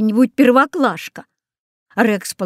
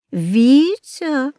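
strange voice; 5 semitones higher than usual. A narrator's reading. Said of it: "каким-то странным голосом сказал папа".

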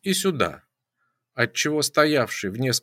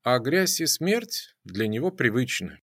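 ordinary delivery, nothing marked

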